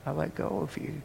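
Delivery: hushed voice of God; quiet God voice. Acted. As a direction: say it softly as God letting go. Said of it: "he said".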